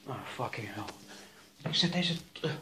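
ordinary delivery

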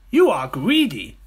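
high, loud, drawn-out reproach; accusing smaller crocodile. Acted. Smaller crocodile telling off the big one, high and accusing. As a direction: scold as the little croc.

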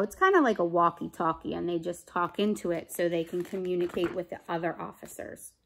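ordinary delivery

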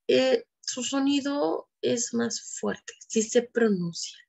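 ordinary talk

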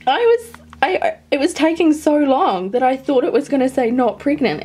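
high, loud, stumbling rush of feeling; real giddy relief; excited relief. Real, not acted. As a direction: blurt it out, overwhelmed and elated. Relieved after positive result.

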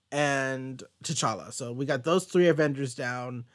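normal talking voice

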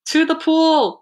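high, loud rallying shout; full-voiced storybook yell; yelled command. A narrator's reading. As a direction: yell it out like an order to the crew. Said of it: "yelled the fireman".

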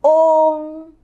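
Ung with the lips left in a small ring; open-lipped Ung; teacher repeats model; Chinese ung. Shown as a demonstration. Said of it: "lặp lại một lần nữa".

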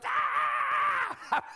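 high, loud panicked shriek; imitated scream. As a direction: shriek like she just met a snake. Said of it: "she screamed".

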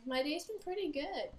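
ordinary talk, nothing marked

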